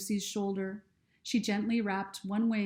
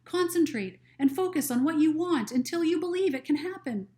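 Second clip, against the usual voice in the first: higher, earnest recited affirmation; reading aloud; 7 semitones higher, 5 dB louder than usual. Acted. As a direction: read it out like a mantra. Reads from her journal.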